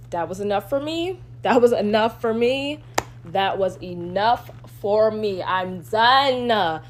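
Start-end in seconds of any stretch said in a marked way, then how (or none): 1.4-2.8 louder, punchy and fed up; exasperated insistence. Real, not acted. Repeats herself, building in exasperation. 3.3-6.8 "enough" and "for" stretched out, hit hard; emphatic exasperation. Real, not acted. Third take, fed up and done.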